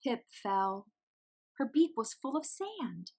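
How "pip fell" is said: ordinary delivery, nothing marked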